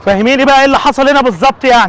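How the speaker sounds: loud, high demanding tone; angry demand. Acted. Father demanding his daughter explain, angry. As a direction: demand the full story now.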